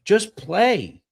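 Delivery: exasperated imitation, high and loud; Stu Lance. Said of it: "have you heard Stu Lance on these calls".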